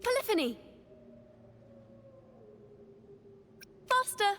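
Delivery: high, eager musical cues; excited calling. Acted. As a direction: call it out to the band. Bard calling out during performance.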